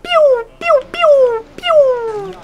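high, drawn-out "pew" noises; imitated laser pews. Player mimicking gunfire mid-game, high pews. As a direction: shoot high laser sounds with your voice.

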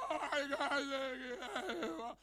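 half-laughing mock cry of no; labouring woman. Pastor imitating a woman in labour, mock crying no.

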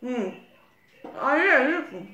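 humming while eating muffin; muffled by food; mouth full, chewing. Real, not acted. Seen 0: Woman chews and bites muffin while humming; mouth full.

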